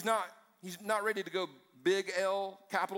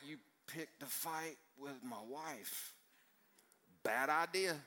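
low-key, slow warning; soft and deliberate, as a protective husband warns; quiet menace; 4 semitones lower, 7 dB quieter, slower than usual. Acted. Preacher voicing Jesus warning Saul about his bride, quietly menacing. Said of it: "Jesus is like".

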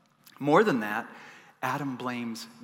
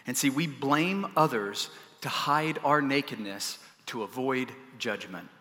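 usual speaking voice

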